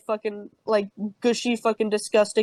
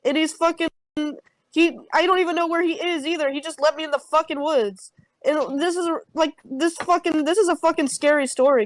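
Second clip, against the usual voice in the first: higher-pitched, agitated telling; worked-up storyteller; 6 semitones higher than usual. Real, not acted. Girl telling podcast friends her scary abduction story, worked up. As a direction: get worked up over your own scary tale.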